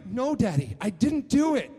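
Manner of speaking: put-on high, loud little boy denying; denying kid. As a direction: say it like a child swearing innocence. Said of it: "son answering his dad".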